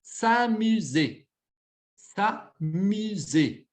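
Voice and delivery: S'amuser stretched slowly, sa-mu-zé, falling at the end; slow pronunciation model. Shown as a demonstration. Teacher presenting a new verb to the class, slow and clear. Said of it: "é esse verbozinho".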